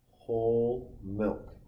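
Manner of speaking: long, slow, grossed-out drawl; disgusted drawl. Real, not acted. Talking to viewers about gross whole milk, slow disgusted drawl. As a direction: stretch whole milk out like it turns your stomach.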